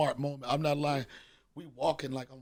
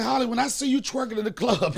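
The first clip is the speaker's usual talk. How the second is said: higher, louder, laughing; laughing retort; 7 semitones higher, 6 dB louder than usual. Retold or imitated. Comedian teasing Holly about the club, laughing. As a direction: tease back through laughter. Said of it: "I'm like".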